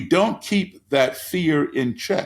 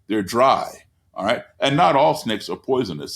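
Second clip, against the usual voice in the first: normal talking voice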